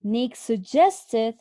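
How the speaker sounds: "suggested" said slowly and stressed. Shown as a demonstration. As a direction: draw out "suggested". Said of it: "we underlined suggested".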